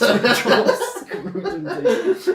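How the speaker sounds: high, drawn-out words dissolving into laughter; laughing. Real, not acted. Friends inventing a gross band name, high slow laughing. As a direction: squeal it out through real laughter.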